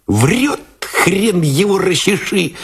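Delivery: high, slow jeering gunner; mocking snigger. A narrator's reading. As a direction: sneer it with a snicker. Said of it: "захихикал насмешливо".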